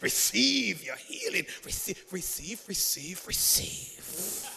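slow, drawn-out mock healing prayer, fading. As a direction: imitate a faith healer intoning "receive". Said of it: "that's what I think preachers sound like".

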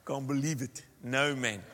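joking South African Afrikaans accent. Shown as a demonstration. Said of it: "That's Afrikaans".

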